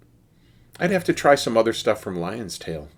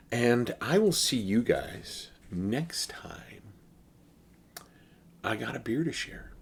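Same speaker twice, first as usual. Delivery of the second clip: slow whisper; whispered sign-off; 3 semitones higher, 6 dB quieter than usual. Real, not acted. A beer reviewer whispers goodbye to viewers, slowly.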